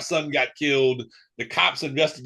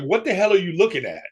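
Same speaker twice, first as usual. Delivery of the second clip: higher-pitched, mildly angry retort; retold indignant challenge; 6 semitones higher than usual. Son challenging his mother for staring at him, indignant. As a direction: throw it out as a remembered challenge.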